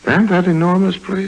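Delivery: amazed high question; astonished. Acted. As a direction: voice jumps high in disbelief. Amazed at the mansion ahead.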